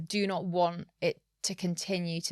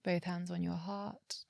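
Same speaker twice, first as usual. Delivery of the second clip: guided meditation; 7 dB quieter than usual. Acted. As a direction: calmly guide each breath. Leads a breathing exercise.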